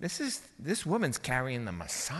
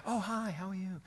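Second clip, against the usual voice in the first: soft, breezy small-talk hello; mock casual greeting; 4 semitones higher, 6 dB quieter than usual. Preacher showing how Elizabeth did not greet Mary, mock casual. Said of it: "No, she's like".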